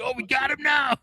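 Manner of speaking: high-pitched gloating imitation; mock triumph. Mocking critics who pounce on the tweet, high mock triumph. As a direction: crow it like a gleeful accuser.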